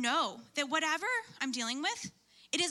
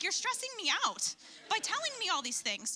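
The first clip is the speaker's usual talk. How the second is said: high, exasperated mock protest; stressed-out retort; 7 semitones higher than usual. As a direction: throw it back high-pitched, fed up. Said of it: "oh, it's so stressful".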